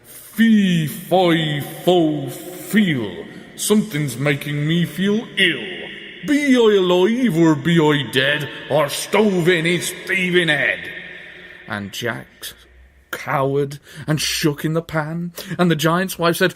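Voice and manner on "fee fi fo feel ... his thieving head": slow, drawn-out giant bellow. A narrator's reading. Giant sniffs out an intruder, slow bellowing. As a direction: bellow it out slowly like an ogre. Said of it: "began to bellow".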